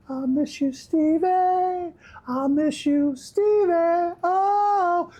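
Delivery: high, drawn-out lovesick crooning; mock lovesick wail. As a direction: ham up a pining ex-lover, stretching the words. Mock longing for [name].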